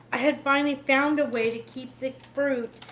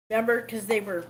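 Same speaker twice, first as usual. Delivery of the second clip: ordinary delivery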